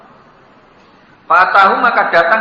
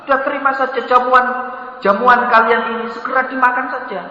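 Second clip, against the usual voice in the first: higher, fast pleading; urging guests; 8 semitones higher than usual. Acted. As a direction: press them to eat, quick and high. Abdurrahman mendesak tamu makan. (Abdurrahman mendesak tamu dengan nada tinggi)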